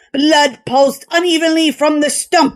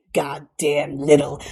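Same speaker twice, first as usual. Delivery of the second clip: lower, softer grumbled curse; muttered curse; 8 semitones lower, 6 dB quieter than usual. A narrator's reading. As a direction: grumble it under your breath. Cook muttering after the bite.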